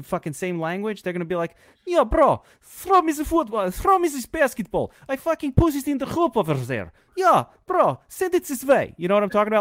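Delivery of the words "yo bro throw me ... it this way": mock European accent. Imitating Porzingis calling to Doncic in a mock European accent. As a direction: ham up a young European teammate. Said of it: "That's a great impression".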